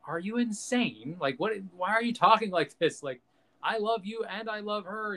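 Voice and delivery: lightly dramatized pleading; Obi-Wan. Retold or imitated. Obi-Wan confronts Anakin in imagined dialogue, lightly acted disbelief. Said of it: "Obi-Wan's like".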